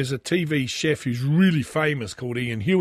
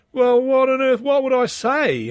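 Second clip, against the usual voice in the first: flustered wife; 10 semitones higher, 5 dB louder than usual. Retold or imitated. Dad voices fretting wife.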